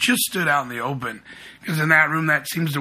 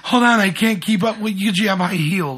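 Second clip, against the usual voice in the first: higher-pitched imitation whine, laugh in it; mock struggling complainer; 5 semitones higher, 4 dB louder than usual. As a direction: lightly mimic someone pleading to wait up. Mimics girls struggling on trail.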